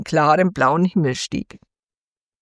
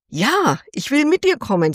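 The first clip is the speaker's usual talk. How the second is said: put-on higher, gladly agreeing girl voice; eager little yes; 7 semitones higher than usual. A narrator's reading. Thumbelina accepts the swallow's offer, higher and eager. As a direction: jump at the chance, lightly voiced.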